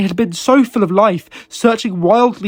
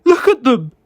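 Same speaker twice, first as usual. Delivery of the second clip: shaking voice; 4 semitones higher, 5 dB louder than usual. A narrator's reading. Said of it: "his voice shaking".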